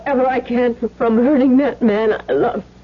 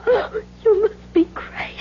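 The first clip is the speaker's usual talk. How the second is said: shocked gasp; 11 semitones higher than usual. Acted. Wife shocked by blackmail demand.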